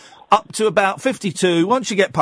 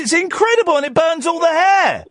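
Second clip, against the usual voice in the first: higher, excited near-shout; 9 semitones higher than usual. Real, not acted. Host raving about Turkish barbers, excited.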